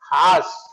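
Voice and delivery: "हास" with an open aa, no r; horse as haas. Shown as a demonstration. Teacher tells students to say horse as "haas". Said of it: "हॉर्स नहीं बोलेंगे".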